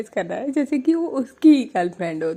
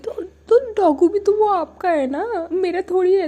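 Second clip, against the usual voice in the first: pleading; 11 semitones higher, 5 dB louder than usual. Retold or imitated. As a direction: plead the dog is not hers. Girlfriend pleads about dog.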